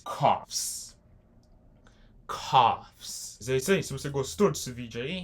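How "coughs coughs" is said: coughs said as kofs, the final s kept audible; English plural demo. Shown as a demonstration. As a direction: model coughs for learners. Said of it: "se tiver plural". (pronúncia de coughs com o s final)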